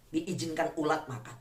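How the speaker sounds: ordinary talk, nothing marked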